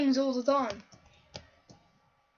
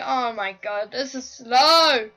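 normal talking voice

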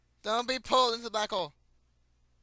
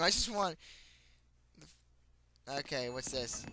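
normal talking voice

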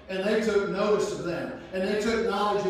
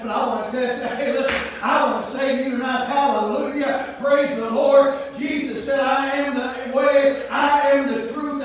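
preaching at full force; 4 semitones higher, 6 dB louder than usual. Acted. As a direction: proclaim it, stretching the words. Preacher exulting, hallelujah.

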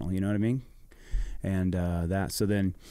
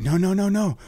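high, loud refusal, a lot higher; loud high insistent no; defiant no; 10 semitones higher, 6 dB louder than usual. Retold or imitated. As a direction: push back hard against them. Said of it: "I'm like".

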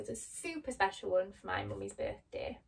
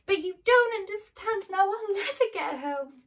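much higher and louder, anguished plea; despairing; 10 semitones higher, 8 dB louder than usual. Acted. Dorothy sobbing to Glinda about home, despairing. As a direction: cry it out through tears.

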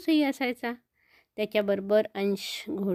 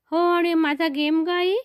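put-on higher, louder eager child's voice; little boy; 7 semitones higher, 5 dB louder than usual. Boy asking his mother about his birthday game, eager child voice. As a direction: ask like an excited small boy.

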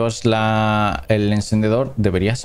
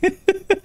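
high giggle. Real, not acted. Laughs after mock cry.

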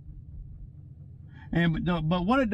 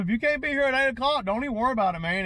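higher, raised voice laying down the law; rehearsed ultimatum; 5 semitones higher, faster than usual. Retold or imitated. Boss rehearses ultimatum for late young worker, raised and firm. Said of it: "I'm just gonna tell him".

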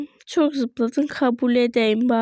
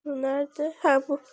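ordinary talk

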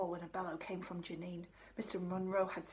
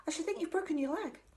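put-on gloomy girl voice; 9 semitones higher, 5 dB louder than usual. Gloomy girl predicting disaster.

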